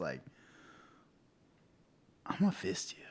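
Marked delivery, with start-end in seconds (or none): 2.3-3.0 hushed, voiceless menace played for laughs; softly whispered comic warning; whispered threat.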